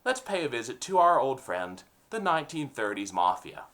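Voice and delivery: usual speaking voice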